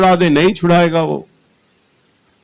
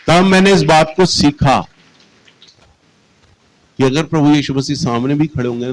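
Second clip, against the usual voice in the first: usual speaking voice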